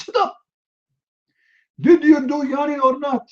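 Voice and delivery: very high, hammed-up angry demand; irate court interrogator. Sharia court judge silencing the accused yawner, shrieky mock anger. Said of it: "they say to you".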